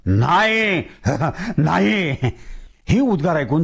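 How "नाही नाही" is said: sulky ghost voice with a laugh; ghost's refusal. Acted. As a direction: refuse like a stubborn spirit. Ghost refuses, possessed boy.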